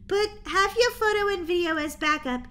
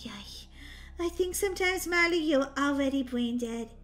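usual speaking voice

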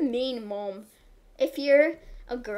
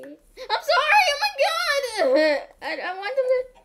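laughing shriek; 13 semitones higher, 7 dB louder than usual. Real, not acted. Dropping an egg by accident, laughing shriek. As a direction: shriek sorry through laughter.

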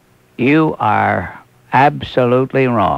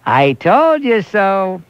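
slow, deliberate, each word laid down; pointed I told you so; 4 semitones higher, slower than usual. Real, not acted. Host to his audience about Rudy, slow and pointed. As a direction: savor every word of it, slowly. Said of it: "here's a new one for you".

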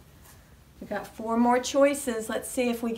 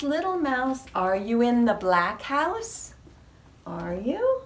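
usual speaking voice

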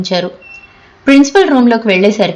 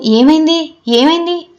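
anxious, high-pitched questioning; 7 semitones higher than usual. Acted. Classmates crowding round a fallen girl, anxiously asking. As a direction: ask higher, with worried urgency. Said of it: "కంగారుగా చరితను చూడసాగారు".

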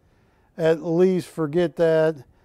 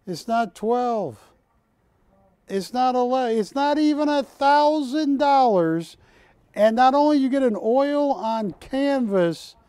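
rising sales excitement; 6 semitones higher than usual. Real, not acted. Host counting down the price for viewers, rising excitement. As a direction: build the hype as the price drops.

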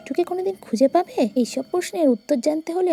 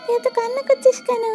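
much higher, hurried and urgent; anxious madwoman; 6 semitones higher, faster than usual. Acted. Madwoman hurrying the crying child away, urgent. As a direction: rush the child home, fretting.

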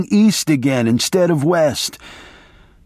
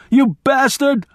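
high screamed insult; panicked high scream of abuse; 11 semitones higher than usual. Retold or imitated. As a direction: scream it at him down the phone. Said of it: "I screamed".

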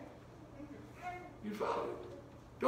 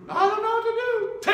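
mock whine; 8 semitones higher than usual. Mocking a fearful believer.

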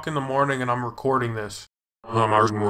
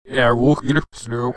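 ordinary delivery

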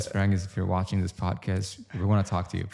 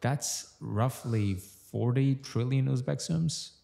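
mock awe; 5 semitones higher, much slower than usual. Mock-dramatic money exaggeration.

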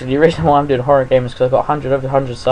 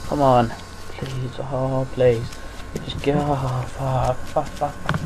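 hushed anxious begging under the breath; quiet nervous muttering; scared pleading; 8 dB quieter, slower than usual. Real, not acted. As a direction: plead softly, barely voiced.

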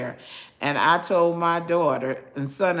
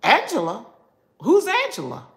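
high-pitched, indignant echo of the name; affronted disbelief; 7 semitones higher than usual. Acted. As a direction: throw the name back, scandalized. Acted affronted echo of first name.

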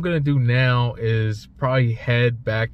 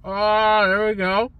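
talking around clenched cigar; cigar in mouth; 7 semitones higher, slower than usual. Real, not acted. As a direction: lips pressed around cigar. Seen 0: Cigar clenched between lips throughout while holding up the ash.

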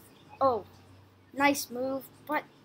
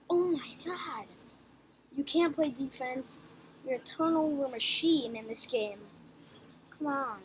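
usual speaking voice

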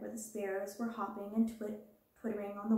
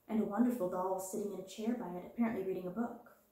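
plain reading voice

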